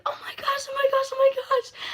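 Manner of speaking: very high, loud squealed whisper; reenacted high-pitched gasping squeal; overwhelmed excitement. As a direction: squeal it breathlessly, reliving big news. Reenacts reaction to twins news.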